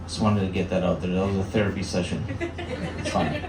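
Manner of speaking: soft, low, wry mutter; quiet aside. Real, not acted. Comedian muttering an aside to the audience. Quiet self-deprecating wrap-up.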